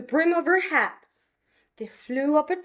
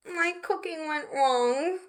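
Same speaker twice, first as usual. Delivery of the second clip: softer, sighing apology; sighed sorry; 3 semitones higher, 6 dB quieter than usual. Acted. Betty apologising to Mum, soft sigh. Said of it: "sighed Betty".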